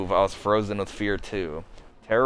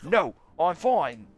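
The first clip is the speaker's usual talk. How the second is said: character voice; 11 semitones higher than usual. Acted. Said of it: "my accents are kinda all over the place for this character".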